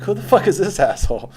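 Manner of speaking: sudden high, loud indignant yelp; indignant outburst. Retold or imitated. Reading a post about himself, he shouts in indignation. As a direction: blurt it out, outraged at the poster. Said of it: "I'm like".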